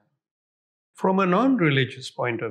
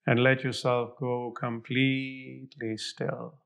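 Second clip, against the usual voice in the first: guided meditation; 3 semitones lower, 3 dB quieter than usual. Acted. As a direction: fade softer toward silence. Guided visualization meditation continues.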